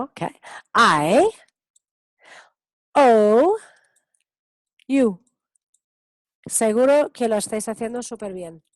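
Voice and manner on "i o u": "I, O, U" loud, as English letter names; vocal modelo para repetir. Shown as a demonstration. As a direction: model "I, O, U" loudly, one at a time. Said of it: "quiero que repitéis conmigo". ("I, O, U" en inglés, fuerte y separado)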